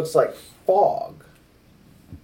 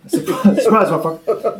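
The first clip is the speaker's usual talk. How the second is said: laughing; 6 semitones higher, 4 dB louder than usual. Real, not acted. Echoing the joke reveal.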